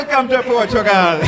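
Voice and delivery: loud, high mock Portuguese greeting; over-the-top faux Portuguese accent; botched Portuguese accent. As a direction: belt it out in a bad Portuguese accent. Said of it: "I wish that I got the accent right".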